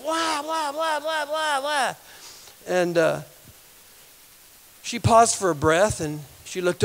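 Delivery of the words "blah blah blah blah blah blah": high, droning blah-blah rant; mock ranting. Imitating the woman cursing on the street, mock ranting. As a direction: imitate an oblivious tirade on one high note. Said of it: "she was yelling, shouting, screaming profanities".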